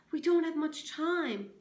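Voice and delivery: high loud urgent plea. A narrator's reading. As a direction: press him to hurry. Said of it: "urged Catherine".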